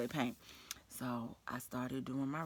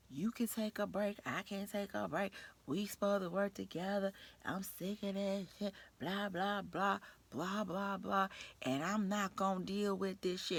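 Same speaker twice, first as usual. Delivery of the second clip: higher, sharp griping rant, a bit slow; mock angry coworker; 6 semitones higher, slower than usual. Coworker going off at the narrator, mock angry rant. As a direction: play the fed-up coworker venting. Coworker goes off about breaks.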